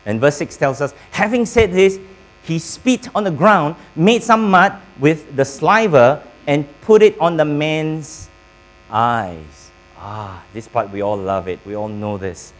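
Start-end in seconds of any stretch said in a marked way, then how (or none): 1.1-9.7 raised, louder scripture reading. Acted. Preacher reading the verse to the congregation, raised and louder. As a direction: proclaim the verse to the church.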